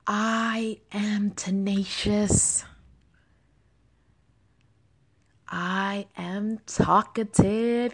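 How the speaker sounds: slow, calm affirmation read, each line left to settle. Acted. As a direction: let every affirmation land slowly.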